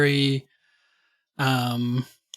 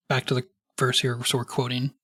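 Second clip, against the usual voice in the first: normal talking voice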